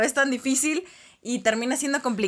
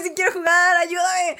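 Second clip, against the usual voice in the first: mock desperate plea; 6 semitones higher than usual. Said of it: "un grito desesperado".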